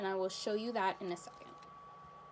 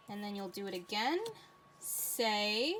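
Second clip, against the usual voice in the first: normal talking voice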